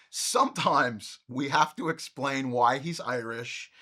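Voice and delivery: usual speaking voice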